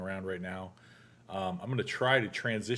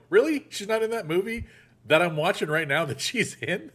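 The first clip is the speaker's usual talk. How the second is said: much higher and louder, mock-outraged "Really?" with "not" rising; incredulous disbelief; 10 semitones higher, 7 dB louder than usual. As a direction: scoff at the AI in disbelief. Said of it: "I go".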